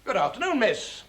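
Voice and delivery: high, lifted greeting; lighter demonstration. Shown as a demonstration. Director showing actor the greeting, light and high. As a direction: greet her lightly, pitched well up. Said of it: "I'll show you what I mean".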